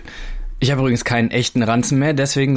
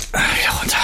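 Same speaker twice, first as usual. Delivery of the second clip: effort. Shown as a demonstration. Heaving the heavy rucksack down.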